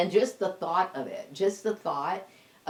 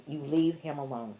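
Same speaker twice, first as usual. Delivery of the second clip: ordinary delivery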